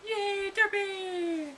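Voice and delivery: high, drawn-out squeal; excited cheer. Real, not acted. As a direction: cheer it out with glee. Cheering for a character.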